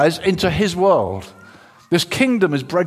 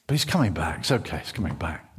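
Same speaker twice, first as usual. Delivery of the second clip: low, hushed murmur; relieved self-reassurance; 12 semitones lower, 6 dB quieter, faster than usual. Acted. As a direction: soothe yourself softly after a fright. Simon Peter reassuring himself again.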